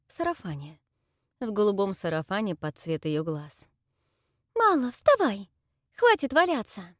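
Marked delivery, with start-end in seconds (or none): 4.5-6.9 high, loud cheerful shout; loud wake-up call. Acted. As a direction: shout her awake, bright friend. Friend Salina wakes the heroine. (громкий оклик подруги)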